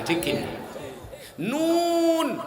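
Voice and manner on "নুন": "নুন" held long and high, ringing in the nose; nasal "নুন". Shown as a demonstration. Preacher demonstrates the nose letter, "নুন" drawn out with nasal ring. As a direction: stretch "নুন" so it buzzes nasally. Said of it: "নাকের মধ্যে ঝ্যানঝ্যান করে".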